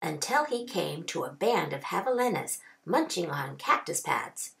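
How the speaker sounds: ordinary delivery, nothing marked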